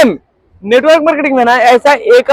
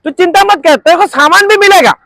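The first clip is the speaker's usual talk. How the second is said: higher, eager mock salesman reassuring a doubter; hammed-up recruiter, higher voice; mock pushy recruiter; 8 semitones higher, faster than usual. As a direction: play the slick recruiter brushing off worries, pitched up. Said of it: "कुछ लोग बोलते".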